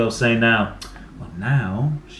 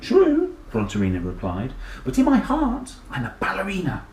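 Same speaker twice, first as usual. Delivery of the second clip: high voice fading to a whisper on ballerina; a dinosaur confessing her secret dream; dreamy dinosaur girl; 7 semitones higher than usual. Acted. Dinosaur tells the teacher her dream, high then whispered.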